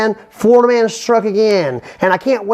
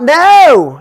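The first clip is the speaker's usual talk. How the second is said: loud, higher yelled No; 5 semitones higher, 8 dB louder than usual. Retold or imitated. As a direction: shout it at the door in panic. Florida man yells at cops.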